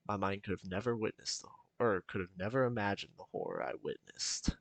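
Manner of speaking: hushed, tense story reading; story narration. A narrator's reading. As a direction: read it low and ominous. Reads a horror story aloud.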